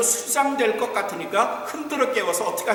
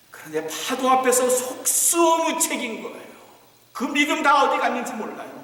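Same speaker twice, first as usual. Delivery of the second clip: ordinary delivery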